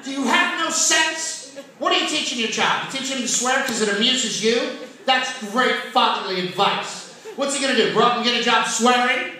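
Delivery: high, loud sarcastic scolding; scolding wife. Retold or imitated. Wife scolding husband for teaching swearing, loud and sarcastic. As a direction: nag him hard, dripping sarcasm. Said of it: "She come in the room".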